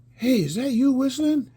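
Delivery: high, loud call-out; imitated older guy. Older guy calling to a whistling kid, high and loud. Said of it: "so he's like".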